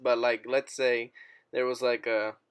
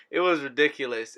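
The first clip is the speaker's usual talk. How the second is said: ordinary talk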